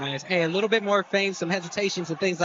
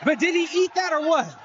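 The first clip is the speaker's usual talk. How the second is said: excited; 6 semitones higher than usual. Real, not acted. Excited call of a big punch.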